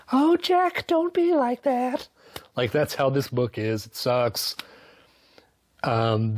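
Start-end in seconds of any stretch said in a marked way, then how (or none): 0.1-2.1 high, slow pleading falsetto as the wife; mock pleading wife. Mocking the wife Wendy pleading with her husband, high falsetto. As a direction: beg him sweetly in a mock high voice.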